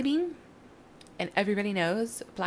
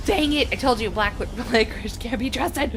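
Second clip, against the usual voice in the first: loud, laughing complaint; loud laughing outburst; 5 semitones higher, 5 dB louder than usual. Pretend. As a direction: shout it through laughter. Blames licorice as lantern falls.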